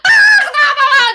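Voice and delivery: high, loud panicked shrieking; mock terrified screams from a grown man; panicked shrieks. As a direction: shriek like a scared chicken. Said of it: "he turned into a chicken".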